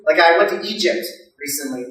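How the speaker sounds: usual speaking voice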